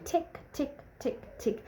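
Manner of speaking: high then whispered tick tick; playful ticking. Retold or imitated. Flirting with her date, playful tick-tick noises. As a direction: tease him with a little ticking sound.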